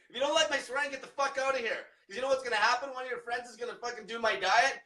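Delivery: normal talking voice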